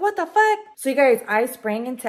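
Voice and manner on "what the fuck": high, shocked what the fuck; jolted; startled outburst. Retold or imitated. Reacting to hearing of fire.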